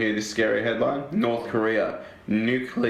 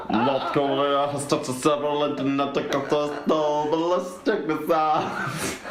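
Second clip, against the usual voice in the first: [name]. Acted. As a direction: slur the news like a reggae toaster, slowing at the end. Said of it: "That was my [name] impression".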